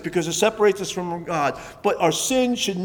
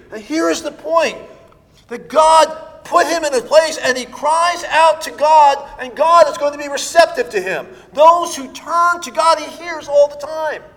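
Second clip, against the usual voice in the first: raised, loud pulpit delivery; forceful preaching; 7 semitones higher, 5 dB louder than usual. Real, not acted. Preacher telling the congregation God hears those who turn, preaching forcefully. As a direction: drive the point home to the congregation.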